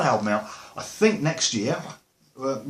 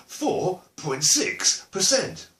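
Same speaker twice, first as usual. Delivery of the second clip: Four point six percent, stretched out slowly; slow and drawn out; 5 semitones higher, much slower than usual. Shown as a demonstration. Answering his own question to viewers, slowly drawn out. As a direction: drag out each word for emphasis. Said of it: "How many, [name]?".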